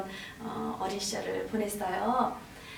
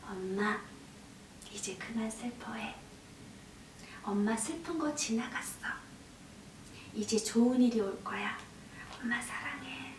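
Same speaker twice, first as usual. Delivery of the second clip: put-on hushed, caring child's reassurance; tender consoling; 9 dB quieter than usual. Seven-year-old daughter hugging her sad mother, hushed and tender. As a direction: comfort softly, phrase by phrase with pauses.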